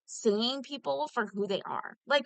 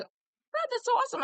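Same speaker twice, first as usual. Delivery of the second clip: gushing; 3 dB louder than usual. Retold or imitated. Retelling her gushing reply.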